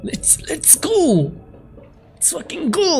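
high, stretched-out hype cheer; excited high-pitched drawn-out cheer; hyped rallying cry. Real, not acted. Hype as game starts.

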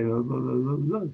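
Quasimodo voice with fingers in mouth. Retold or imitated. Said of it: "Put my finger in my mouth and say".

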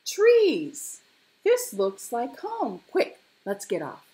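high, loud burst of excitement; eager cub, urging a friend on; excited cry. A narrator's reading. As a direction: cry out in delight at the sight. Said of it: "cried the little tiger".

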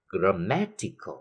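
"grammatical" slowly, punched on MAT; stress on MAT. Shown as a demonstration. Teacher drilling the stress rule, MAT stressed in "grammatical". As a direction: draw it out and hit MAT, gram-MAT-i-cal. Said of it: "cách nó một vần về phía trước".

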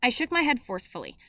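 ordinary delivery

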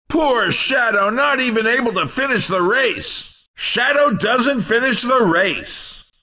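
normal talking voice

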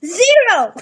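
loud high proud shout; emphatic exclaim. Real, not acted. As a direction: punch it out proudly.

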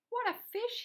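high, loud burst of amazement; astonished storybook policeman; amazed exclamation. A narrator's reading. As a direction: marvel loudly at the sight. Policeman amazed by huge fish.

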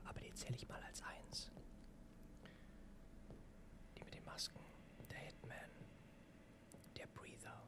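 hushed, breathy whisper throughout. Real, not acted. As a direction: keep it a secret whisper. Keeps whispering to chat. (flüsternd)

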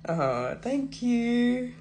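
slow, touched and tender; tender thanks. Real, not acted. Mother thanking her daughter for the praise, slow and tender. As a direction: melt a little as you thank her.

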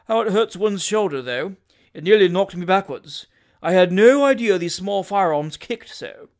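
lightly voiced aggrieved gentleman; self-important shooter. Shooter sore from the gun's kick, self-important shooter. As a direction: complain loudly of a sore shoulder. Voices Tupman after the shot.

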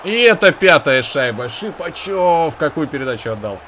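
loud, high, slow goal cry; excited hockey goal call. Real, not acted. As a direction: stretch the goal call out, thrilled. Fifth goal scored.

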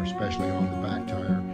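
ordinary talk

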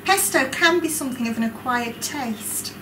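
ordinary talk, nothing marked

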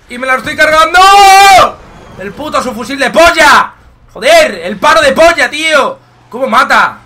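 loud angry rant; furious shout. Real, not acted. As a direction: rage at the gun. Killed repeatedly, raging at gun.

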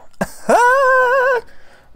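very high loud cackle; shrieking laugh. Real, not acted. Cracking up at own pun, high shrieking laugh.

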